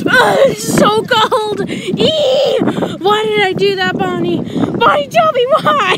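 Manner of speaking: high, loud shivery shrieks; shrieking from cold. Real, not acted. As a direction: yelp at the freezing water. Just jumped into cold water.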